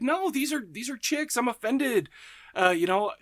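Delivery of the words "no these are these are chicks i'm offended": higher, mock-indignant protest; a retold huff of wounded pride; offended protest. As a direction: play the insulted director, pitched up high. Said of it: "he's like".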